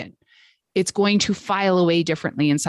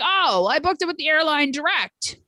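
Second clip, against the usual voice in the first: mock gruff client; 8 semitones higher than usual. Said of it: "this client was very gruff sounding on the phone".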